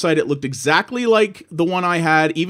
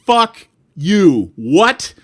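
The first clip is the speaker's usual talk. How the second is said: louder, outraged swear; angry outburst; 2 semitones higher, 5 dB louder, much slower than usual. Retold or imitated. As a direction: spit it out in disbelief. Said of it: "And I'm like".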